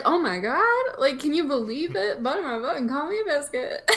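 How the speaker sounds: high excited Southern lady; Southern gushing voice. Retold or imitated. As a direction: gush like a Southern belle. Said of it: "It's like".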